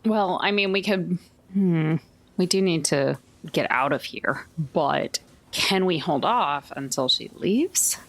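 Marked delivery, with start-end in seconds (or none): none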